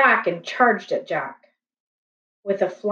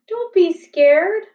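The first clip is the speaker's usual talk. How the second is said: put-on high, slow little-girl reassurance; soothing girl; 11 semitones higher, 4 dB louder, slower than usual. A narrator's reading. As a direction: coax her gently as young Annie. Annie comforts scared gorilla.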